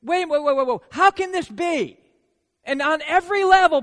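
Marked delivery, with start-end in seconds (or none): 0.0-2.0 higher, louder, halting wait wait wait; startled young woman stammering; Mary's disbelief. Retold or imitated. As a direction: voice Mary caught off guard. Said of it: "She's basically saying".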